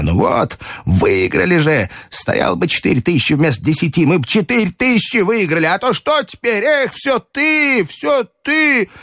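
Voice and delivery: reproachful grandmother. A narrator's reading. Grandmother nudging and blaming the narrator, reproachful. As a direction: climb in pitch as the blame mounts.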